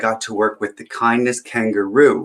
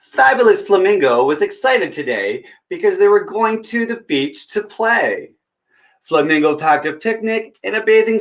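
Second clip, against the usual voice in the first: put-on bright, higher read-aloud voice for kids; storybook reading; 10 semitones higher, 4 dB louder than usual. Storyteller reading a picture book to children, lively. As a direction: read it like story time at the library.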